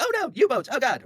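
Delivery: high, loud mock panic over sighted boats. Narrator mimicking sailors in a hammed-up panic at boats. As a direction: squeal it like a jumpy sailor spotting U-boats.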